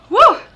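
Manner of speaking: sudden loud high woo; a quick yelp of surprise; startled woo. Real, not acted. Medium jolted while describing Ken, loud high startled woo. Sudden jolt during reading.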